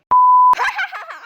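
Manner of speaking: a high, loud burst of laughter. Real, not acted. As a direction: explode into a cackle.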